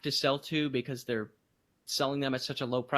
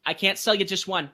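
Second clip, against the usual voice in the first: louder, higher mimic of a cashier; imitating store clerk; 5 semitones higher, 5 dB louder than usual. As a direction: lightly act out the clerk's firm refusal. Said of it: "the lady immediately points at me".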